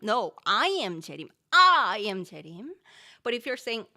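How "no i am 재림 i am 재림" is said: loud insistent I am 재림; I punched loud and firm; stress on I. Shown as a demonstration. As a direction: claim it's you, not that guy. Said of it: "not other people, but me".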